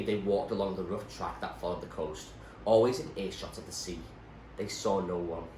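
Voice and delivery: plain reading voice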